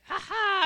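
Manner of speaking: loud, high imitated child's "ha ha"; mock gleeful laugh. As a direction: burst out in mischievous mock glee. Nephew gleefully smashing the picture.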